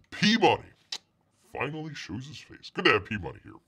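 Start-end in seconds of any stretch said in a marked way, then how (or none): none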